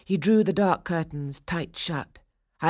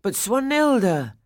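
high, loud protest; pleading cry; 7 semitones higher, 5 dB louder than usual. A narrator's reading. Franz protesting to Swanhilda, crying out. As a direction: cry out her name in protest. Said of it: "Franz cried".

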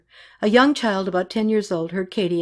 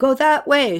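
raised, higher urgent call; urgent direction; 7 semitones higher, 4 dB louder than usual. A narrator's reading. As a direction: send them off urgently. Directing fleeing people to safety.

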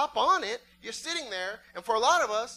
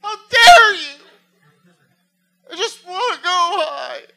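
high, loud mock wailing tantrum; crying kid; 11 semitones higher, 12 dB louder than usual. Kid on the swing wailing at the pusher, mock crying tantrum. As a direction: bawl like a spoiled little kid. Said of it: "start crying".

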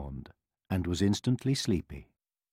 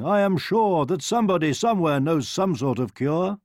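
king voice; 6 semitones higher, 4 dB louder than usual. A narrator's reading. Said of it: "said the king".

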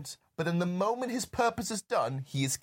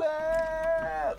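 high hammed-up whoa; a roller coaster yelp; mock whoa; 12 semitones higher than usual. Friend echoing Sean Connery's fall, high mock whoa.

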